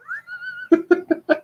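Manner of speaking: a sudden high giggle at his own flub; high self-mocking laugh. Real, not acted. Host laughing at his own tangled sentence, high giggle. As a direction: laugh at yourself, high and quick.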